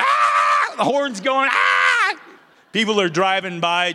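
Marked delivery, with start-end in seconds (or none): 0.0-0.7 low, loud yell through the car window; tunnel scream. Retold or imitated. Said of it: "I'm screaming". 1.5-2.2 high, loud joyful yell; tunnel scream. Retold or imitated. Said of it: "I'm screaming".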